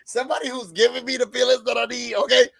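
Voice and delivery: high, thrilled gushing; excited. Retold or imitated. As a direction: swoon over what he said. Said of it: "I was like".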